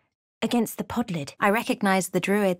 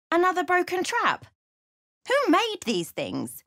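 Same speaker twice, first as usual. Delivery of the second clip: exasperated high voice; 11 semitones higher than usual. Acted. Exasperated goblin.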